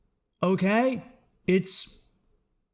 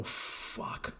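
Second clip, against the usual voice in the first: whispered gasp; 14 dB quieter than usual. Retold or imitated. Reaction to a cold shower.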